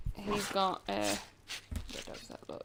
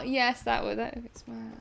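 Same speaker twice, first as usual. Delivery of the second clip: usual speaking voice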